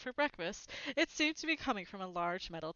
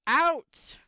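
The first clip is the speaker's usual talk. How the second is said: very loud, higher roar; 8 semitones higher, 12 dB louder than usual. A narrator's reading. Uncle roaring the boys out of the kitchen, very loud. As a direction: roar the word out. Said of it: "roared Uncle Vernon".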